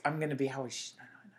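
whispered quick counting; muttered aside; whispered to self. Real, not acted. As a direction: work it out under your breath. Counting to herself.

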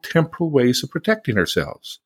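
ordinary talk, nothing marked

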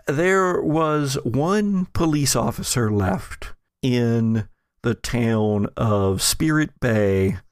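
ordinary talk

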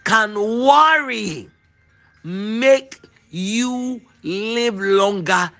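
very slow, lingering, "worry" stretched high; very slow savouring. Shown as a demonstration. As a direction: savour each word of the verse. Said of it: "this is too beautiful".

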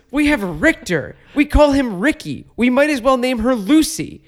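high, exasperated, loud rant; hammed-up furious father laying down the law; retold fit of rage. Dad renaming the dog in mock rage. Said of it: "in a fit of rage".

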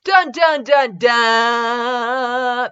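loud sung dramatic sting; dramatic dun dun. Acted. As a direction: belt it like a soap opera sting. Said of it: "That was in the text".

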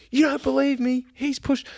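high-pitched mock whine; shrieky retold protest; protesting passenger. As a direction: imitate her aggrieved accusation, far above your own voice. Imitating the irate passenger protesting.

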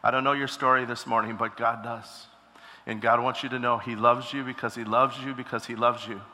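hushed prophetic prayer voice. Acted. Continuing prophetic encouragement.

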